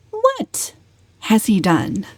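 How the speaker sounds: a high, insistent push on What; inexorable. A narrator's reading. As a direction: press the question without letting go. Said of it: "repeated Valancy inexorably".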